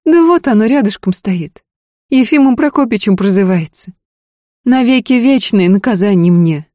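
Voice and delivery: Устинья, outspoken wife. A narrator's reading. Wife tells husband he is her punishment, outspoken. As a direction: needle your husband, then turn serious. Voices the wife's lively retort.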